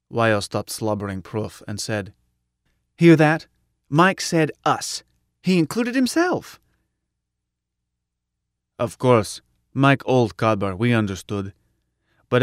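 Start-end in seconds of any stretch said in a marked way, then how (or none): 3.0-6.6 high, loud delighted cry; excited Wyo. A narrator's reading. Wyo points out Mike said us, high and thrilled. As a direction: she can't believe her ears.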